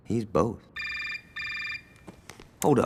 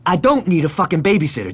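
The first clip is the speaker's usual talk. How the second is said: angry retort; 6 semitones higher, 7 dB louder, faster than usual. Acted.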